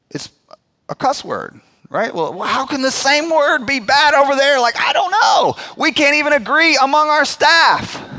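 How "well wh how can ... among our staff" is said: louder and higher, throwing up his hands in disbelief; half-laughing protest that nobody agrees; exasperated outburst. Real, not acted. Pastor venting to the congregation about swear words, exasperated and loud.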